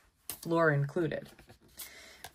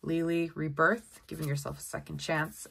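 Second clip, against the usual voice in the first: ordinary talk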